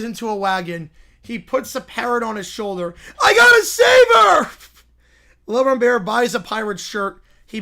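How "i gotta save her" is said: desperate shout. Acted. Bear resolves to rescue.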